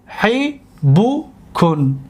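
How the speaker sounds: حبوکن very slow, with gaps between syllables. Shown as a demonstration. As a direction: sound out حبوکن as hi, bu, kun. Teacher sounds out example word.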